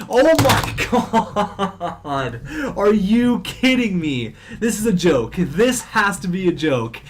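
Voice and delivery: slow, high, laughing disbelief; disbelieving laugh. Real, not acted. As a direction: laugh it off in shock. Disbelief at five goals against.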